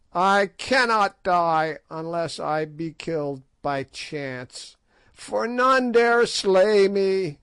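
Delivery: a hag gloating that she cannot die; old sorceress proclaiming her endless age; ancient boasting witch. A narrator's reading.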